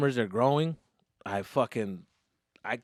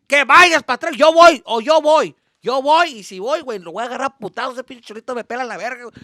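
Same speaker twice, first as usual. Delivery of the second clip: loud, higher menacing rant in Spanish; threatening dad; 7 semitones higher, 8 dB louder than usual. Retold or imitated. Dad threatening to go fetch it himself, angry rant. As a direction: threaten to go beat the boy up.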